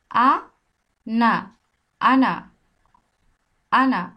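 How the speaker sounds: 「আনা」 said loud and clear after a spelled 「আ」「না」; spelling out Ana. Shown as a demonstration. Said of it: "চলেন একটা উদাহরণ দিয়ে দেখি".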